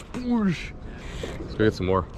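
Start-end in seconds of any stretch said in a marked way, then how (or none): 0.0-0.7 rod sound effect. Retold or imitated. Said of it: "And then my rod just goes".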